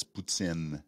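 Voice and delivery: "poutine" as poo-TSIN, with ts; Quebecois poutine. Shown as a demonstration. Host correcting the Anglophone way, "poutine" as poo-TSIN. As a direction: end "poutine" on a short, French-Canadian "tsin". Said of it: "when an Anglophone is saying it".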